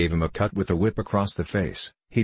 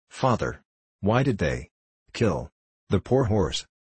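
halting, broken-up plea with pauses; a touch of a sob, the words coming haltingly; mild sobbing. A narrator's reading. Child asking his father about the horse, mildly sobbing. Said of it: "he sobbed".

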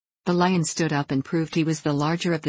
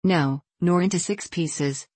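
usual speaking voice

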